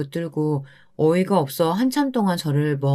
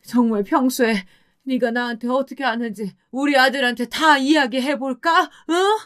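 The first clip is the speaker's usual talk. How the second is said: mildly raised scolding; 6 semitones higher than usual. Acted. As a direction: scold son and daughter-in-law, a touch tearful. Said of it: "울면서 저희에게 소리치셨습니다".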